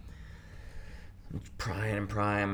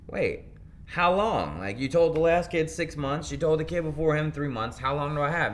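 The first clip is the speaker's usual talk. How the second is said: indignant protest; 7 semitones higher, 4 dB louder than usual. Retold or imitated. Protesting open sentence.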